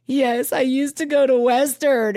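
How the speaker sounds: high, drawn-out mock wail; mock sobbing. Sobbing student answering the paramedic, mock tearful. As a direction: imitate answering through exaggerated sobs. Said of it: "uncontrollably sobbing".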